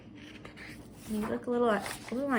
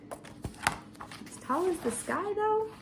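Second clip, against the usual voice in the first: doubtful wonder; 9 semitones higher, 5 dB quieter, much slower than usual. Real, not acted. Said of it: "I don't know".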